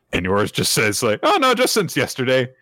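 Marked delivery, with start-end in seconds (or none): 1.2-2.5 much higher, airy innocent wife voice; high innocent reply; Yor's innocent voice. Retold or imitated. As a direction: chirp it out sweetly clueless. Yor's naive reply retold.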